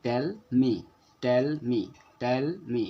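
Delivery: "Tell me" in separate beats, "Tell" held longer; stress on Tell. Shown as a demonstration. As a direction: linger on "Tell", then drop onto "me". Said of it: "पहले ज़्यादा देर इस पे देख पढ़ें". ("Tell me" में "Tell" पर ज़्यादा देर रुककर)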